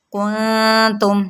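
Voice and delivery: کنتم slow with a hidden nasal noon; Quranic recitation. Shown as a demonstration. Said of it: "نون پہ اخفا". (اخفا کے ساتھ آہستہ)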